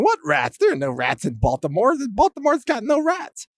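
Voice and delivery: put-on voice leaping to a high pitch; mock denier. Politicians denying rats in Baltimore, mock innocent denial. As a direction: mimic someone absurdly insisting all is fine.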